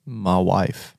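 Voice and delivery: low, soft country mumble; bereaved villager. Acted. Villager grieves killed wife, low and soft. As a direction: say it low and mournful.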